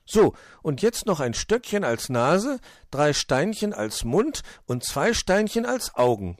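high, lilting list; busy little hedgehog. Acted. Borstel finishes the snowman's face, busy and high.

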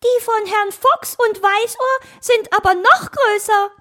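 very high, loud little-creature voice; cheeky know-it-all. Acted. Little Borstel corrects the hare, cheeky high squeak. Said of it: "stellte Borstel naseweiß fest".

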